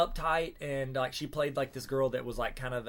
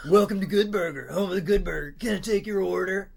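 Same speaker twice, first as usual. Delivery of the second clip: higher, louder Ed greeting; Kel Good Burger voice; 4 semitones higher, 5 dB louder than usual. Pretend. Trying to beat cohost's Kel, raised voice. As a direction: sing out the counter welcome like Kel. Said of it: "Let me try".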